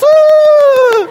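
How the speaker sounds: very high, loud mimicked shriek; little girl's squeal. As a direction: squeal piercingly like a little girl. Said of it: "And the girl goes".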